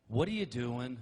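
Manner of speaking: sharp startled woman's question; indignant woman. Retold or imitated. Woman in a dark room catching her man. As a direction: snap it like a startled wife in the dark. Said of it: "it goes just like this".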